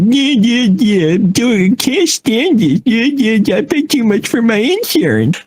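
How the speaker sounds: very high mock griping; ranting customer. Customer venting to the agent, hammed-up grumbling. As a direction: imitate a grouch rambling on in complaint. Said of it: "I just let him talk for about five minutes".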